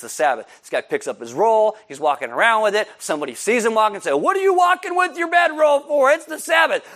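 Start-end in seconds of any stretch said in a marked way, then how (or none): 4.1-6.8 high, loud outraged shout; hammed-up scandalized official; indignant shout. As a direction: scold him for breaking the Sabbath. Religious leader confronts healed man.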